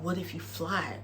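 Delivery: soft, low, mock-profound; dreamy. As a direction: breathe it out like a guru. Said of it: "And Mercedes is like".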